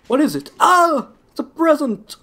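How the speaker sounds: high, loud squeal of hammed-up delight; mock surprise. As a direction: gasp and shriek like a kid opening a gift. Mock gift skit to enemy.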